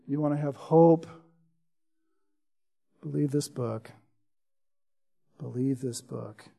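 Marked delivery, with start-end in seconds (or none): 3.0-3.9 much softer earnest urging; quiet appeal. Acted. First of repeated appeal. 5.4-6.5 even softer, low, intimate repetition; near-whispered echo. Acted.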